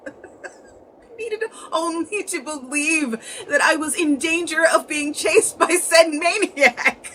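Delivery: laughing wildly through the words, high and slow; a giddy, unhinged laugh breaking through; cackling madman narrator; manic laughter. A narrator's reading. Narrator laughs giddily at thrill.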